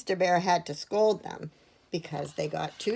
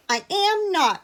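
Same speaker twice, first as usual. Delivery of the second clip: put-on high, loud child's protest; cheeky, high-pitched contradiction; defiant retort; 12 semitones higher, 6 dB louder than usual. A narrator's reading. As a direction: answer back indignantly. Edith talks back to scolding.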